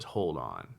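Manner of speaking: low, soft mock menace; low quiet menacing "hold on"; menacing landlord.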